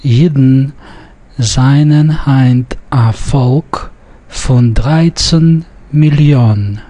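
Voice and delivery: slow, word-by-word reading with Millionen stressed on its last syllable, "mili-ON"; short I as in fine in seinen, Heint, dreizehn; Yiddish pronunciation model. Shown as a demonstration. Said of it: "it falls on the last syllable".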